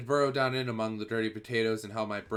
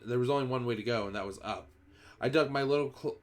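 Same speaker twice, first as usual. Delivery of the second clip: usual speaking voice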